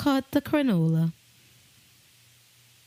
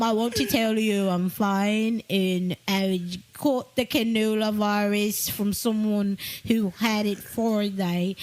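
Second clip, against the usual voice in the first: mock British accent; 3 semitones lower, slower than usual. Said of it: "And then he go".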